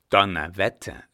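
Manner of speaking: laut und erschrocken herausgerufen; lauter Ausruf. A narrator's reading. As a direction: geblendet aufschreien. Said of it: "rief der Mann". (lauter, überraschter Fluch)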